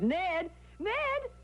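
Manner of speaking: high, excited call down the stairs; calling up high and bright with excitement. Acted. Wife calls husband down the stairs to see, excited shout. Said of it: "I called excitedly down the stairs".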